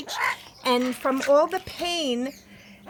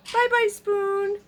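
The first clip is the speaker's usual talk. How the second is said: high sing-song; 7 semitones higher, slower than usual. Real, not acted. Owner cooing goodbye to the spoon, high and slow.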